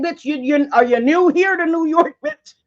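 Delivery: high, loud imitation of an irritated woman; hammed-up New Yorker scolding a tourist; annoyed New Yorker. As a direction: sneer at her like she's clueless. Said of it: "looked at me like if she could have smacked me".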